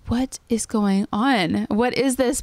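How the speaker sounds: slow, drawn-out disbelief, rising at the end; retold bewilderment, stretching out each word; bewildered. Overwhelmed new mother recalling the chaos, bewildered. Retelling her overwhelmed, confused moment.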